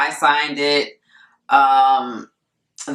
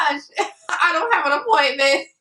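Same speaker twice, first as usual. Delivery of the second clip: dismayed; 9 semitones higher, faster than usual. Retold or imitated. Dismay at the bank.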